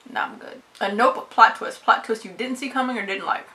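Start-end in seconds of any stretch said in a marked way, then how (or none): 0.1-0.6 whispered "Nah, I'm good" under the breath; quiet dismissal. Real, not acted. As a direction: brush it off in a whisper. Dismissing the werewolf option.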